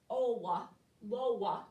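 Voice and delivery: owa, lowa said loud with the w sounded out; wrong pronunciation. Shown as a demonstration. Teacher mocking the wrong w sound, loud. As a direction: overdo the wa sound, loudly. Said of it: "we don't pronounce this o".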